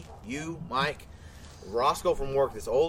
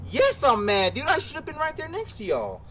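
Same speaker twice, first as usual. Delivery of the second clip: mock angry complaint; 11 semitones higher than usual. Admitting jealousy to Diesel, high mock whine. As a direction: whine it out, high and put-upon. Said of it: "I'm like".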